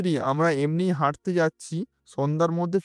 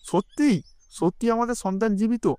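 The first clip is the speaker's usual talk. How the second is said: surprised joy; 5 semitones higher than usual. Acted. King to sage, stunned by the news. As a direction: gasp it out, stunned and hopeful. King hears daughter is alive.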